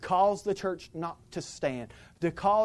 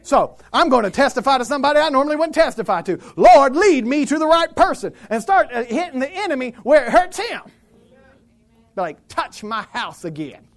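loud, high-pitched revival preaching; fiery preaching; 9 semitones higher, 13 dB louder than usual. Acted. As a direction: preach it hard, rally the church. Preaching at full force.

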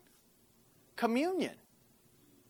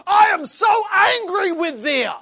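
much higher and louder, slow furious shout; mock angry God; 10 semitones higher, 11 dB louder, slower than usual. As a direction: ham up a raging wrestler-style god. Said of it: "not like a W W E competition".

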